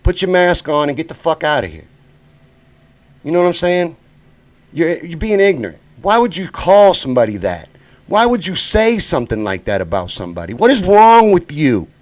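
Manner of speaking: heated, loud scolding at the chat; really fed up, tearing into them; genuinely angry yelling at a rude commenter; angry outburst. Real, not acted. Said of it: "y'all got me mad for real".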